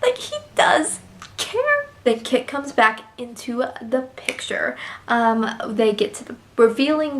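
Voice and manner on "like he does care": teary. Retold or imitated. Realizing the character cares.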